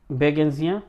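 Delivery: usual speaking voice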